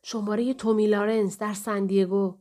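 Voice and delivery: ordinary delivery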